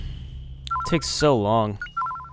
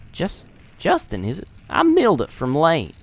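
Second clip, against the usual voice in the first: put-on Southern accent; 3 semitones higher than usual. Gamer voicing Milda in a Southern accent. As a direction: tease the name in a Southern accent. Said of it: "southern and whatever accent".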